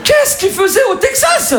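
very high, drawn-out exclamation; hammed-up high-pitched astonishment; incredulous shout. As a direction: cry out in mock disbelief, slowly. Mock astonishment at the anecdote.

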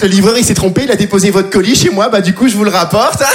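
normal talking voice

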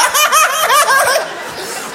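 high, squeaky fake laugh; courtesy laugh. Mocking his own polite laugh at the bakery, high and squeaky. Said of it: "Ce rire de courtoisie".

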